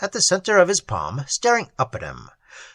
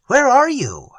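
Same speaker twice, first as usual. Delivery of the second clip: frightened; 9 semitones higher, 5 dB louder than usual. A narrator's reading.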